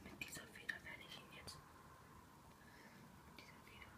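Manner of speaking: whispering. Real, not acted. Whispering beside sleeping friend.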